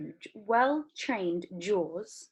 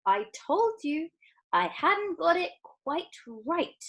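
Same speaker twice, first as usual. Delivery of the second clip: sighing; 9 semitones higher than usual. A narrator's reading. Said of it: "sighed Mister Wonka".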